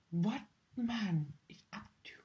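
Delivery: soft, breathy secret question; whispered aside. Acted. Plotting with her daughter, whisper. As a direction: lean in and whisper it.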